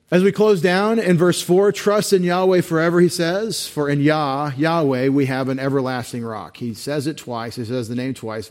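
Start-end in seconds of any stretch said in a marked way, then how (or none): 0.1-6.6 raised, fervent scripture reading; preaching. Acted. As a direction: proclaim the verse, higher and louder. Reads verse with rising force.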